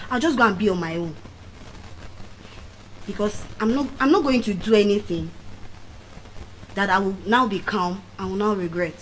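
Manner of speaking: ordinary talk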